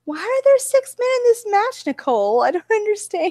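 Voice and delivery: high, whiny mom impression; mock confused mom. Friend mimics the mom at a wrestling match, high and whiny. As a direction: whine like a baffled mother.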